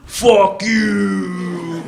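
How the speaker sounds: imitated drunken rock-singer yell; drunken scream. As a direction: howl it like a drunk singer into a mic. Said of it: "just scream".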